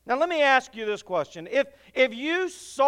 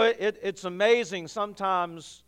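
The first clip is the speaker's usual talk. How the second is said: ordinary delivery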